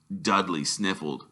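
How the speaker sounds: ordinary delivery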